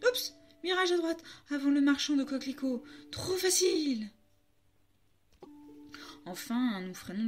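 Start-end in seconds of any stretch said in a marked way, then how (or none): 0.1-4.1 très aigu, rapide au milieu; crie. Acted. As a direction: guider en criant les directions. Nestor guide encore. (Nestor crie ses directions, aigu)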